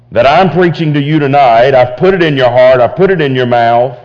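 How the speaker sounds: pleading, rising sermon voice; full-force preaching. Acted. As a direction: drive the point home to the congregation.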